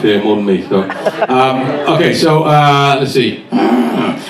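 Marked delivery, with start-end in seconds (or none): 3.5-4.2 croaky cartoon wife seduction; murmur, then croon in cartoon character; Marge Simpson. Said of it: "an adult version of The Simpsons".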